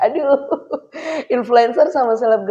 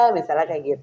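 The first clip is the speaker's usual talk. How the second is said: usual speaking voice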